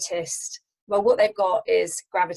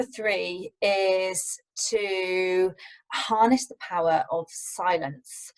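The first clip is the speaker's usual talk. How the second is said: slow and deliberate; much slower than usual. Shown as a demonstration. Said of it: "demonstrates slow, paused delivery".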